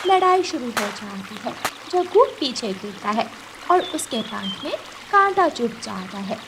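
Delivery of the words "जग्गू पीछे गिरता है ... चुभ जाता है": usual speaking voice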